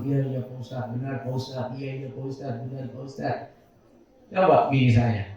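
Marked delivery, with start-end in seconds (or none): 0.0-3.5 soft, low, dutiful yes-yes; meek agreeing. Retold or imitated. Other men's wives meekly agreeing with the preacher, soft and low. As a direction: nod along softly like an obedient pupil. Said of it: "Pasti mangut-mangut".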